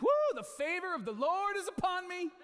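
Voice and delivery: high, loud whooping mock praise; mock cheering. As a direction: cheer like a fan whose team just won. Mock fan after team wins.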